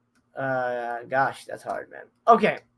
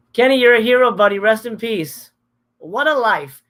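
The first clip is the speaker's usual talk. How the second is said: loud, raised tribute called out; heartfelt shout; 3 semitones higher, 9 dB louder than usual. Real, not acted. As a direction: call out to him with full feeling. Calls out tribute to late Kenny.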